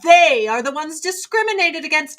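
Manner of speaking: shrill-free high mock lecture; mock indignant defender. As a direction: sneer at the self-righteous crowd. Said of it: "everyone's like".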